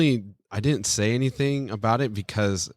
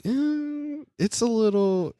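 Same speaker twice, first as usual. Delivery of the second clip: hesitant; 12 semitones higher, slower than usual. Retold or imitated. Retold hesitant reaction to joke.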